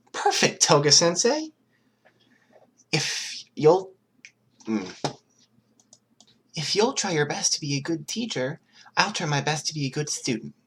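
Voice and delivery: eager to please the new teacher; eager Izuku. Acted. Izuku cheerfully accepting Toga as his teacher, eager.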